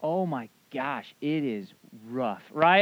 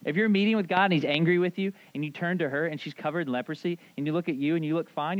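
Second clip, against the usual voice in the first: usual speaking voice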